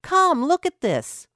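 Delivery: high, loud eager shout; excited call. A narrator's reading. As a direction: call mom over, bursting with excitement. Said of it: "he called".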